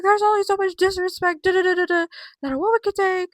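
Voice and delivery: high, loud mock tantrum; Becky. Vlogger mimicking Becky's fit over disrespect, mock tantrum. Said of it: "She's throwing a fit".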